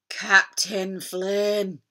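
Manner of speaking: very slow, halting dinosaur plea; stammering. Acted. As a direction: drag the name out, beaten and faltering. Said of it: "he stammered".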